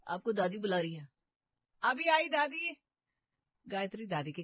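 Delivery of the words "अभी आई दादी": calling back. Acted. Answering grandmother from afar.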